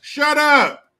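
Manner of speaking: loud, high-pitched outburst; mock angry shout. Rulers silencing grumbling citizens, mock angry shout. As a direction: cut them off with a shout.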